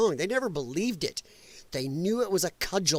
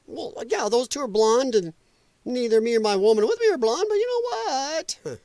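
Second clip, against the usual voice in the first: higher, louder put-on protest; mock excuse-making; 6 semitones higher, 3 dB louder than usual. Acts migrant making excuses.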